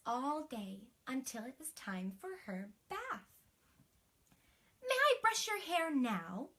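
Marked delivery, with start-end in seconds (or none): 4.8-6.5 queen asking. Acted. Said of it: "said the queen".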